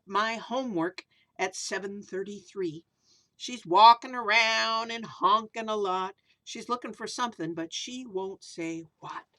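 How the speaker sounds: storybook verse reading; reading to children. A narrator's reading. Reads rhyming picture book aloud.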